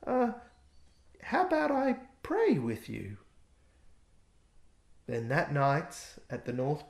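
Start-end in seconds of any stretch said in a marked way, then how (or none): 0.1-3.2 high, hesitant little elf voice; a timid elf shyly offering to pray; nervous elf. A narrator's reading. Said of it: "said nervously".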